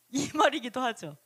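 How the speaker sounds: ordinary talk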